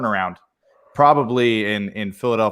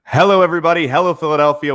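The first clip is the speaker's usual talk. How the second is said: podcast host greeting; 6 semitones higher, 5 dB louder, faster than usual. Acted. Host opening the show.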